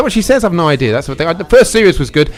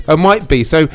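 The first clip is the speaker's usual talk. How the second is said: ordinary talk, nothing marked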